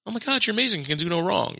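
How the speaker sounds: high, gushing child imitation; adoring young daughter. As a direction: gush like a kid admiring dad. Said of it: "dad's still like".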